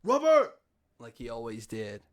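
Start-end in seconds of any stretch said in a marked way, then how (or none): 0.0-0.5 sharp high shout of a name; dad's yell. Retold or imitated. Dad calling his son from afar, yelling. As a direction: imitate a dad hollering for his kid. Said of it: "so my dad yells".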